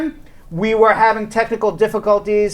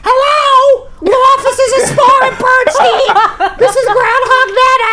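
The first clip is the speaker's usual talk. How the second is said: Nana on the phone. Pretend. Host as Groundhog Nana answering office phones, high and hammed-up. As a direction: sing out a hammed-up granny phone greeting. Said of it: "Nana could be answering the phones".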